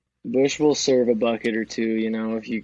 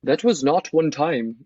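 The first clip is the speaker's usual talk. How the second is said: a higher mock Scottish accent; 4 semitones higher than usual. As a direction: put on a hammed-up Scottish lilt. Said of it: "I gotta do a Scottish accent".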